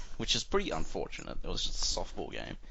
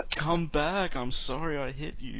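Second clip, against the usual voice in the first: high, whiny begging apology; pleading plumber voice; 8 semitones higher, slower than usual. Pretend. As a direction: plead like a sorry cartoon plumber. Voicing the plumber in comic.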